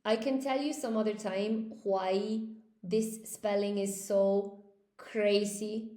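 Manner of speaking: ordinary talk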